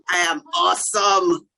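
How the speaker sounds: shouted self-affirmation, bursting with pride; proud shout. Shown as a demonstration. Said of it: "you have to talk to yourself".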